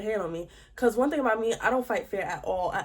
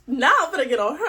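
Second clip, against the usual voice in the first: ordinary talk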